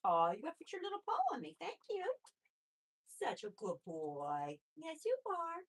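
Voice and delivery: quiet high coo praising the bunny. Real, not acted. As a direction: melt over the bunny's paw. Praising bunny putting paw on her.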